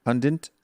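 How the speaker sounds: pundit as "PUN-dint", second vowel swallowed; American pronunciation. Shown as a demonstration. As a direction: slip an n into pundit: "PUN-dnt". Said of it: "two or three ways we say it".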